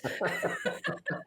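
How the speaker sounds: sudden high burst of laughter; high-pitched laugh. Real, not acted. Laughing at her own mess ups.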